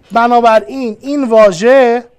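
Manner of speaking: usual speaking voice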